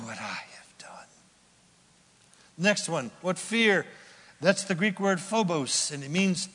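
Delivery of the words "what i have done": low, breathy whisper; whispered lament. Retold or imitated. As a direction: breathe it out in dismay.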